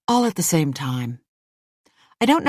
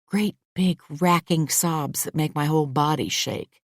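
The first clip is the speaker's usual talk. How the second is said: ordinary delivery, nothing marked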